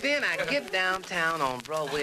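usual speaking voice

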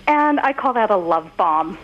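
ordinary talk

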